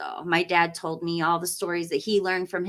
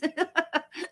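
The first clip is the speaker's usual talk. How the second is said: breathy giggle; 9 semitones higher, 4 dB quieter than usual. Real, not acted. Laughing about the long stream.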